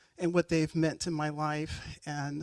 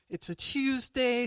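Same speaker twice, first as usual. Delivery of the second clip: usual speaking voice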